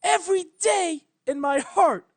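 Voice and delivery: high, loud, breaking cry. A narrator's reading. Twilight cries out at the guard in grief, breaking shout. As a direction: shout it through tears as Twilight. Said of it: "Her voice broke".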